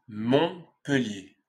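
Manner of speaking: MONTPELLIER split as Mont, pellier; slow MONTPELLIER, pause after Mont; syllable-by-syllable demo. Shown as a demonstration. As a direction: break MONTPELLIER in two for learners. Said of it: "tu as appris à bien prononcer".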